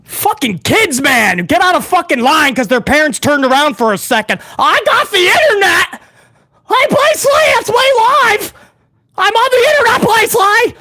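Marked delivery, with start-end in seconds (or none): 4.6-10.8 high-pitched mock child; mocking little-kid voice; bratty internet kid. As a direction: squeal like an excited kid online.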